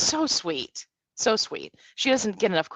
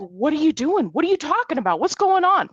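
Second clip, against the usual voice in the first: higher and higher, rising alarm; confused questions climbing to a louder peak; bewildered dad; 8 semitones higher, 4 dB louder, faster than usual. Retold or imitated. Dad, led off by his kid, asks in rising alarm. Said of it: "My dad's like".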